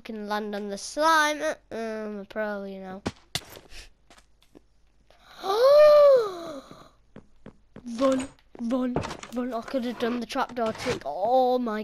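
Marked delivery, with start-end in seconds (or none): 5.4-6.7 long, high, loud gasp; startled gasp. Real, not acted. Player startled mid-game, gasping. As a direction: gasp in sudden shock.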